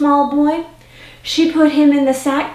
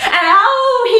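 high, drawn-out "ow"; hammed-up high howl of pain; pained yelp; 11 semitones higher, 4 dB louder than usual. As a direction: cry out as if bitten. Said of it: "he cried".

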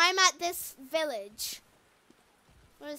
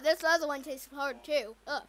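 ordinary talk

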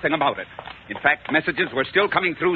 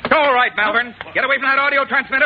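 higher, louder, fast order; commanding shout; 10 semitones higher, 5 dB louder than usual. Acted. Colonel bursts in and orders the traitor from the transmitter, shouting. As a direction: burst in and seize control.

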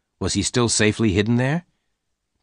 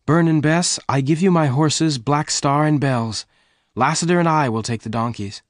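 high-pitched, emphatic woman's voice; generous Jane; 6 semitones higher than usual. A narrator's reading. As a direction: announce the gift in a raised, high voice. Voices Jane giving away horses.

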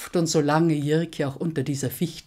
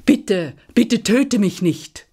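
high, loud desperate plea; pleading dragon; 7 semitones higher, 7 dB louder, slower than usual. A narrator's reading. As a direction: beg for your life, calling out high. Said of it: "Da rief ihm dieser zu". (flehend und laut rufend)

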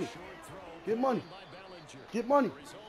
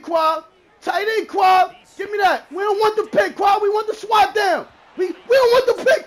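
loud, high-pitched taunting yells; fired-up trash-talk shout; hyped shouting; 7 semitones higher, 7 dB louder than usual. Real, not acted. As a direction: holler the calls over the game. Yelling play calls and taunts.

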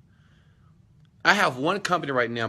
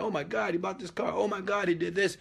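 imitating excited people, higher pitch but held soft; mock excitement; 6 semitones higher, 3 dB quieter than usual. Coach quoting people thrilled about his car, mock-excited high voice. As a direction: lightly mimic a gushing fan, high and contained. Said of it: "when people are very excited".

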